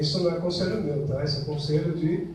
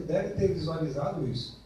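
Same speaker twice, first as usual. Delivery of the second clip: normal talking voice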